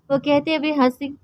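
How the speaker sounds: ordinary delivery, nothing marked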